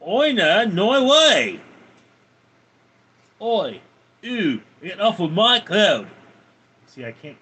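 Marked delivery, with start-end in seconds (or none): 0.0-6.1 loud, high, hammed-up Aussie accent; Australian accent. Shown as a demonstration. Streamer performing on a viewer's request, broad high Australian accent. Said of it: "can you do an Australian accent?".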